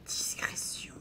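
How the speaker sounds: breathy hushed word; whisper. Real, not acted. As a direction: whisper to stay unseen. Sneaking quietly.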